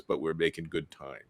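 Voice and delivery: low deadpan caption reply; second cartoon character. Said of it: "The other one says".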